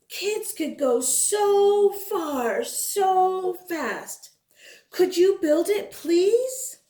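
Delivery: put-on high, pleading kid voice; eager child reading letter. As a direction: read the letter like a hopeful child. Children's letter read aloud.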